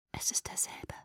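barely audible, voiceless whisper; hushed, stunned whisper. A narrator's reading. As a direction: whisper it under your breath. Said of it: "flüsterte ich".

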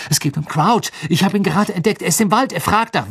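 high, breathless-paced urgent report; urgent alarm. Acted. Harry reporting to Snape about Crouch, urgent and high. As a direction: blurt out the news, pitched high.

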